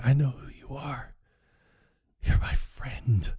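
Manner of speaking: hushed, breath-only confession; slowing into a whisper; mock tender whisper. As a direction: play a heartfelt moment very softly. Acted tender moment between friends.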